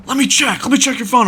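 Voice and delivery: high-pitched, agitated mock demand; retold yelling. Angry man demanding the storyteller's phone, high mock yell. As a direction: imitate a pushy man yelling orders, voice jumped up high. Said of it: "he's yelling at me".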